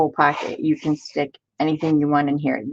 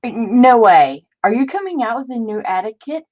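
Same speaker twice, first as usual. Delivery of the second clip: excited disbelief; 6 semitones higher than usual. Real, not acted. Excited by kit news.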